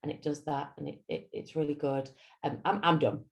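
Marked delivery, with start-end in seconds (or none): 0.0-2.1 mumbled much softer, dull and lifeless; boring monotone demo. Shown as a demonstration. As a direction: sound like you couldn't care less. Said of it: "talking to me like this".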